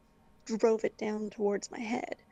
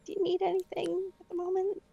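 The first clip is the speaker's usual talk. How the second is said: high sweet voice; 9 semitones higher than usual. Retold or imitated. Retold own line, high put-on.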